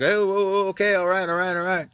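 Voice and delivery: imitating a man backing off; high, repetitive "okay, okay" appeasement; hasty placating. Man backs off when confronted.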